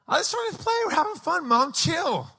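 put-on high-pitched kid making excuses; light, high mock-child dismissal; protesting child. Little boy telling mom they're playing, protesting child. Child brushing off worried mother.

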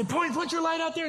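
high-pitched, hurried command; retold urgent order. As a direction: call it out in mock panic. Retold urgent order during bear scare.

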